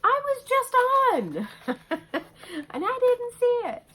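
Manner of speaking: high, loud squeal of mock despair, laughing through it; a cartoonish wail about missing the sale; squeaky frustrated whine. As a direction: play up your own annoyance in a piping voice. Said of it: "And I'm like".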